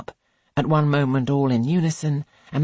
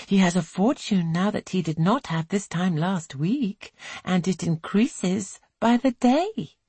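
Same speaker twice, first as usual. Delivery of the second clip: higher, arch gossipy voice; gossiping girl; 7 semitones higher than usual. A narrator's reading. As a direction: relish sharing the gossip. Girl gossips about gentleman.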